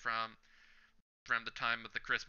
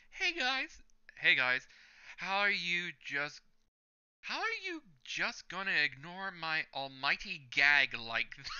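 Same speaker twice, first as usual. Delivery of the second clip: mock-hurt pitched-up complaint; whiny All Might; 6 semitones higher than usual. All Might to the students ignoring his gag, mock hurt. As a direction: whine like a hero whose joke flopped.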